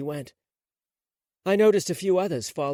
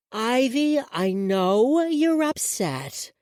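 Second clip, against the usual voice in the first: high, slow, put-on little-girl voice; sugary childish voice stretched out slowly; weird childlike voice; 9 semitones higher, 4 dB louder, much slower than usual. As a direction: talk like a strange small child. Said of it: "in this weird, childlike voice".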